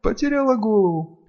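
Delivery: high, slow, rapturous female character; awed, halting high-pitched confession; overwhelmed vampire servant. A narrator's reading. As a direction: confess in a high, swept-away voice.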